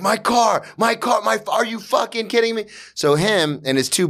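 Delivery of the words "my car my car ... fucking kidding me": high-pitched mock hysteria over damage; freaking out. Imitating his sister who was driving, freaking out in high pitch. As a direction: imitate a frantic, disbelieving driver. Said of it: "is, like, freaking out".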